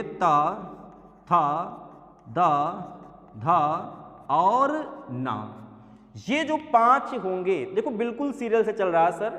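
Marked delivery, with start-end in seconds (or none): 0.0-5.4 त थ द ध and ना said slowly, one by one; dental row recited. Shown as a demonstration. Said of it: "वर्ग उठा लीजिए".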